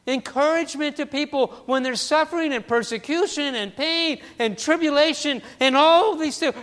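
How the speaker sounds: high, loud preacher's build; rising pulpit exhortation; preaching at full force. Acted. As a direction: lift the congregation up with fervor.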